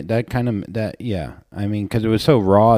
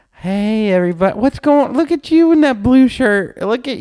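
high-pitched, put-on crowd-work cheer; mock-cheery stand-up opener, pitched way up; upbeat comedian act; 3 dB louder than usual. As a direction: greet the crowd with fake showbiz pep. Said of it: "then we gotta be like".

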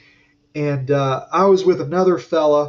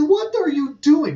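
high, sharp what are you doing; alarmed question; 10 semitones higher than usual. Retold or imitated. Asking the forklift driver, high and alarmed. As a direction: demand an answer in alarm. Forklift nearly hits his toolbox.

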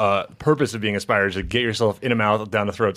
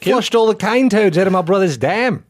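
high, louder Aussie farmer voice; Australian accent; 8 semitones higher, 4 dB louder than usual. Pretend. Riffing on the news story, Australian bloke accent. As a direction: play a rough Australian local. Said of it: "in an Australian accent".

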